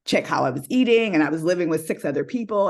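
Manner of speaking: usual speaking voice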